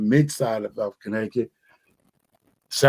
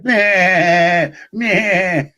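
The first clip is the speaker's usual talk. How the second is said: high mock goat bleating; goat bleat imitation; 6 semitones higher, 4 dB louder than usual. Imitating noisy goats he might keep in the backyard, bleating. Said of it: "people claim they noisy".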